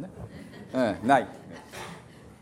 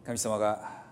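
prayer; 2 semitones lower, 4 dB quieter than usual. Acted. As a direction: pray quietly over the congregation. Leads a closing prayer. (静かに祈る牧師の声)

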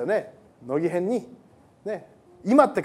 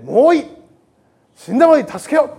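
resolute Fleming; 3 semitones higher, 7 dB louder than usual. A narrator's reading. Fleming's resolve to risk his life.

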